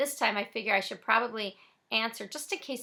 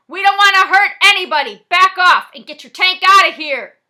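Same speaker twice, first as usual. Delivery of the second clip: much louder, high shout; yelling back; 10 semitones higher, 21 dB louder than usual. Acted. Frosty yells back at the cops outside. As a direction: bellow it at the cops through the door. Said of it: "Frosty yells back".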